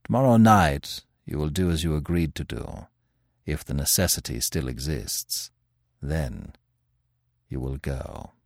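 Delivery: low, hushed and grave; quiet. Acted. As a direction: murmur it like a verdict. Manfred gives grave instructions.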